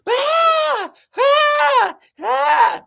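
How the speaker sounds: three high, loud startled shrieks; imitating a woman screaming every time she is startled; repeated imitated screams. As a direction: shriek again and again like a jumpy guest. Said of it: "the ones that would continually go".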